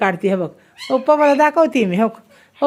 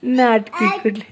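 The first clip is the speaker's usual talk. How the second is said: ordinary talk, nothing marked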